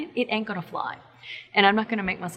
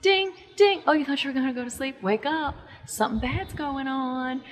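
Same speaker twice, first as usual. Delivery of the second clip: higher-pitched wake-up alert; insistent, raised alarm voice; anxiety's alarm voice; 5 semitones higher than usual. Acted. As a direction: play a nagging alarm jolting someone awake.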